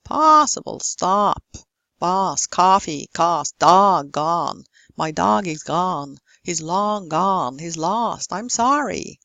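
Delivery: "possible", "dog", "gone" with broad, drawn-out ah; American long ah. Shown as a demonstration. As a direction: exaggerate "coffee" and "lost" as cahffee, lahst. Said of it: "would use the long ah there".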